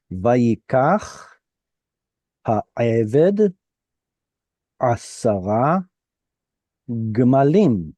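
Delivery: slow chanted Hebrew reading, phrase by phrase; model reading with pauses. Shown as a demonstration. Teacher models the verse for the class, slowly. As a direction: read Vayikach ha'eved with measured pauses. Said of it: "there's a little pause right there".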